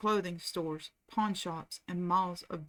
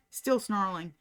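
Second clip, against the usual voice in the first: ordinary delivery, nothing marked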